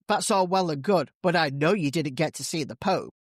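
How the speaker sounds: ordinary delivery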